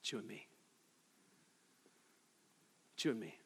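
soft, low, grave; hushed pointed repeat; quiet conviction. Acted.

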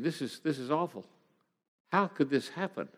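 soft, stunned inner thought; hushed disbelief. Retold or imitated. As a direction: voice drops away in quiet dismay. Stunned, tearful thinking after bad news.

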